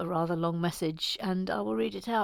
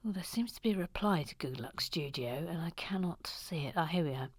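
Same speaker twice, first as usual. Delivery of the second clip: low, soft near-whisper; absorbed muttering; 6 semitones lower, 11 dB quieter, faster than usual. Real, not acted. Host hunting for a chat reply, muttered quietly. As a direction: murmur to yourself while searching.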